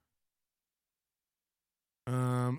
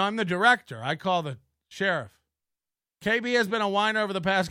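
usual speaking voice

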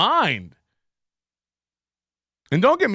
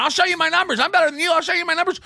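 mocking Kevin; 7 semitones higher, much faster than usual. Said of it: "outside of shopping malls going".

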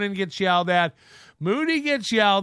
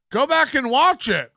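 higher-pitched, hammed-up demand; mock indignant order; 6 semitones higher, 4 dB louder than usual. Imitating Corey begging for views, mock indignant. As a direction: imitate a worked-up host ordering viewers.

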